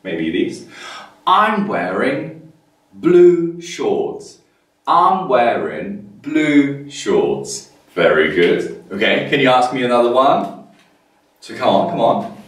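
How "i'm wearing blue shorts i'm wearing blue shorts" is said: slow, careful answer for the class; slow model answer. Shown as a demonstration. Teacher modelling slowly for young learners. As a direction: lay it out slowly. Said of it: "And I'll tell you, maybe these".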